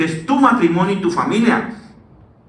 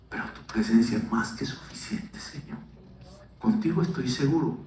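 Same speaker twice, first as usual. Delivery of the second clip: near-whispered, much softer intimate preaching; hushed sermon; 6 semitones lower, 12 dB quieter than usual. Acted. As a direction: lean in and hush the room. Soft intimate prayerful passage.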